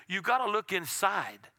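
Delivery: usual speaking voice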